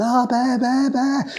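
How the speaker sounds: high-pitched mock fan clamour; crazed fans. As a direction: call out like frantic fans, voice way up. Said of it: "people went fucking crazy".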